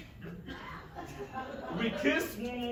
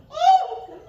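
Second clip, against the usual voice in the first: mock yelp; 12 dB louder than usual. Wife shielding herself.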